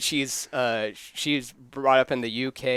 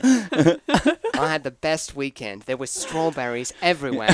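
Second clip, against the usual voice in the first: British accent; 2 semitones higher, 6 dB louder than usual. Shown as a demonstration. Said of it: "I'll try now".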